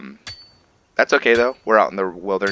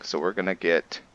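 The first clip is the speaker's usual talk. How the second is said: ordinary delivery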